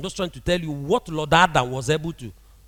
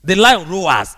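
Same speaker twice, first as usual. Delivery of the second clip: louder, booming like a lion's roar; roaring delivery; 9 dB louder, slower than usual. Shown as a demonstration. Preacher to congregation, showing the lion's voice, booming. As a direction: let the lion's roar ring out. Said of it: "how is the voice?".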